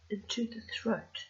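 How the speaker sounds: usual speaking voice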